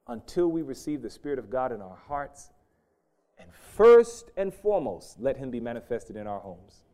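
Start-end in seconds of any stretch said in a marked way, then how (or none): 3.4-5.2 first and foremost hit loud, high and slow; stressed. Acted. Preacher stressing to congregation, loud and slow.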